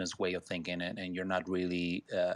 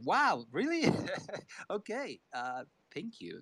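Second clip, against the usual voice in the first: surprised; 9 semitones higher than usual. Retold or imitated. Quoting his grateful surprise.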